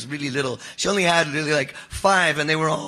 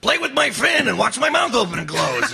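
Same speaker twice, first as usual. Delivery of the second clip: toy shark voice; 8 semitones higher, 4 dB louder than usual. Retold or imitated. Voice actor recalling a toy line for a shark toy, high and hammed-up. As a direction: sell the toy as a big goofy shark. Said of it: "I did Kratoxy Rhina, the great, this big shark thing".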